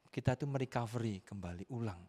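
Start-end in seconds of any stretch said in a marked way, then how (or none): none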